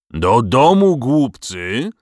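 dragon's roared command. A narrator's reading. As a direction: roar at them to clear off. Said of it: "ryknął".

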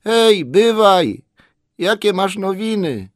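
drawn-out, high, loud hail; trembling shout. A narrator's reading. Hobbit hails a man climbing toward him, trembling shout. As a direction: call out high across a distance. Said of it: "krzyknął drżącym głosem".

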